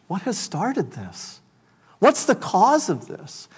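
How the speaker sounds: soft, hushed asking as if awed; hushed wonder. Acted. Worshipper wondering at the heavenly scene, hushed. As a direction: ask it under your breath in awe.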